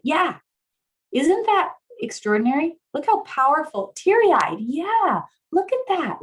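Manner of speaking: high-pitched, emphatic marvelling; excited amazement. Real, not acted. Marvelling at participants' reactions.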